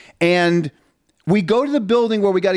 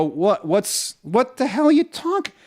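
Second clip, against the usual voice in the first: offended disbelief; 5 semitones higher than usual. Retold or imitated. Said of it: "I go".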